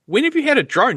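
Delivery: high astonished question; surprised. Retold or imitated. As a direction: ask it amazed.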